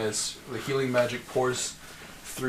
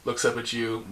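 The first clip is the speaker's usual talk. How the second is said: normal talking voice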